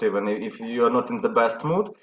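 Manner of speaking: ordinary delivery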